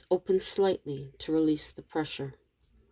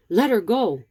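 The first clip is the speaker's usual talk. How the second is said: louder, higher firm order; demanding; 5 semitones higher, 5 dB louder than usual. A narrator's reading. Rob confronting the intruder holding Abigail, demanding. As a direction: demand it with raised voice. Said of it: "Rob demands".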